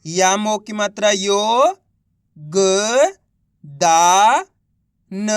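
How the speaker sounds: यो, ग, दा, न held long, one at a time; spelling out syllables. Shown as a demonstration. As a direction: sound out यो, ग, दा, न with clear gaps. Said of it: "एक एक अक्षर का उच्चारण". (यो, ग, दा, न को अलग-अलग खींचकर बोलना)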